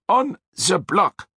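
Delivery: slow, relishing Austrian drawl; satisfied Austrian accent. A narrator's reading. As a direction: savour it in Austrian. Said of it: "he repeated with satisfaction".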